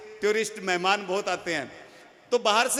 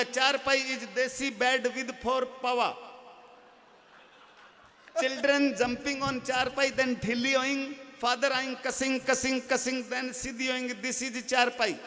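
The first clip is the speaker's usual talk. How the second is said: slow, drawn-out Hinglish; mock broken English; slower than usual. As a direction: explain slowly in broken English.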